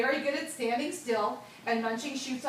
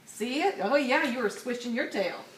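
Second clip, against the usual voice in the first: usual speaking voice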